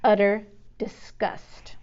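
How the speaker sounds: slow, disgusted murmur; sneering, drawn-out delivery; utter disgust. A narrator's reading. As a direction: let contempt drip slowly. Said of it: "added a new expression".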